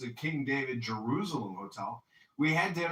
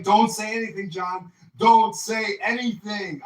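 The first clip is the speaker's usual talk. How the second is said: louder, slowed urgent plea; urgent warning; 6 semitones higher, 10 dB louder than usual. Retold or imitated. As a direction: press the warning hard and loud. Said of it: "And I was like".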